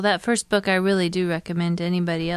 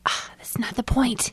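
whispered aside; 4 semitones higher, 4 dB quieter than usual. Real, not acted.

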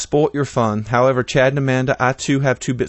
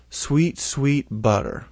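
drawn-out, relishing "Sweet, sweet butter"; savoring slowly; much slower than usual. Acted. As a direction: linger over every word like tasting butter. Savoring butter in read comment.

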